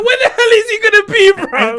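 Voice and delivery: high and loud through laughter; incredulous laughing question. Real, not acted. Laughing disbelief.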